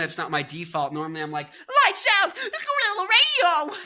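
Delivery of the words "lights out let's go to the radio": high, loud hammed-up rap-rock yell. Imitating a Rage singer for the listener, high hammed-up yell. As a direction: scream it like a rap-metal frontman, beatboxing in between. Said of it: "I can't do [name]".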